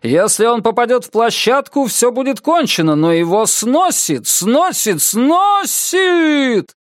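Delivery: commentary building to a high, drawn-out cry; excited play-by-play. Acted. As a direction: follow the ball, then soar on the last word. Commentator follows a dangerous hit, building.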